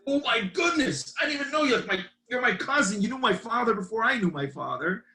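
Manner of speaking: high-pitched, amazed retold outburst; imitating someone freaking out. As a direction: act out the kid's stunned discovery. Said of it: "he freaks out".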